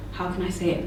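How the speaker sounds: normal talking voice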